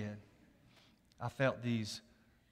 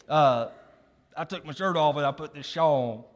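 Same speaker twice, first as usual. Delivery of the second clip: normal talking voice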